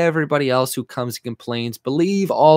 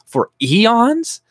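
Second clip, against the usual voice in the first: For eons? thrown back high and loud with a rising disbelieving tune; outraged rhetorical question; incredulous echo; 10 semitones higher, 5 dB louder than usual. Shown as a demonstration. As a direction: repeat For eons? in mock disbelief.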